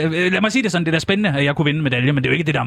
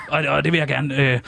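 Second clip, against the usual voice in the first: usual speaking voice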